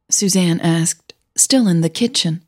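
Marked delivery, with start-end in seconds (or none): none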